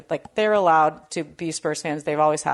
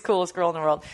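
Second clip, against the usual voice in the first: ordinary delivery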